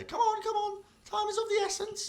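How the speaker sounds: high-pitched urgent beckoning voice; llamando al grupo. Acted. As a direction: call them onward in a high character voice. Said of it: "she's kind of beckoning you all".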